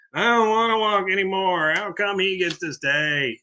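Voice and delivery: high, mock whiny complaint; Dale. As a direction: whine it like a big baby, dragging the end. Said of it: "Such a baby".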